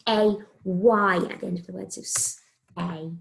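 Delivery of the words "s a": a separate s sound, then long A. Shown as a demonstration. Teacher spelling say for the class, sounded out. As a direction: SAY split into s and A.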